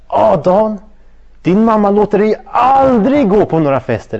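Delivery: mocking friends. Friends on the phone mocking Dan for never going out, loud and high. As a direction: whine it like scoffing teenage friends. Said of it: "De överdriver".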